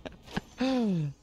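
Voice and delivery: sharp gasp of delight; excited gasp. Real, not acted. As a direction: gasp at the find. Finds the Sanguine Cape.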